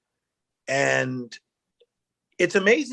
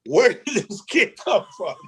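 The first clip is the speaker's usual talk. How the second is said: high, incredulous quoted question; amazed onlooker; 10 semitones higher than usual. Retold or imitated. Coaches reacting to the kid at tryouts, amazed. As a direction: mimic a baffled coach's disbelief.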